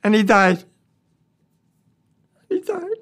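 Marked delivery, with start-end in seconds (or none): 2.5-2.9 real grief, cracking high and softer; voice breaking. Real, not acted. Veteran telling the interviewer the boy died, voice cracking. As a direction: let the voice break on it. Veteran tells of friend's death.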